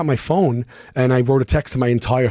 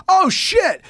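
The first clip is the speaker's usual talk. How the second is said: very high-pitched imitated exclamation; surprised. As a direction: act out a fireman's startled recognition. Fireman's reaction on seeing passenger, acted.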